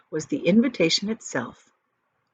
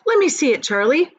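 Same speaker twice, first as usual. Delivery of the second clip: eager; 9 semitones higher, 8 dB louder than usual. Acted. Father eager to see ticket.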